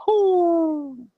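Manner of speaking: long, high-pitched "whoo"; high nervous whoop. Retold or imitated. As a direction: let out a high hoot of nerves. Nerves before walking through the door.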